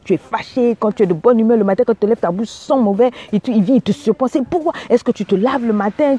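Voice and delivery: normal talking voice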